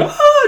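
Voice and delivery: very high squealed oh; electrocuted yelp. Retold or imitated. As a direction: yelp as the current hits. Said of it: "he's like".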